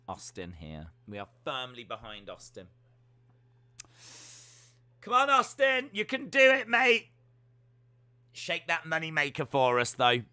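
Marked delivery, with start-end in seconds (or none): 5.0-7.1 high, loud cheer; root for him loudly. Real, not acted. Cheering on Austin during the show, loud and high.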